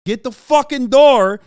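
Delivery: full-throated yell for the door; angry scream to open the door; screaming at husband. Retold or imitated. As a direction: bellow it across the house at him. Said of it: "I keep screaming".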